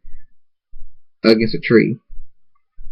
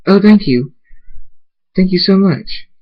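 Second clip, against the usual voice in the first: high-pitched, gushing gratitude; overjoyed thanks; 8 semitones higher than usual. A narrator's reading. Zara thanks the tree for the wand, overjoyed. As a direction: burst out with grateful delight.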